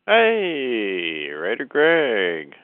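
usual speaking voice

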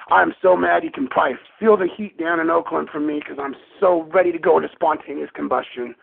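ordinary talk

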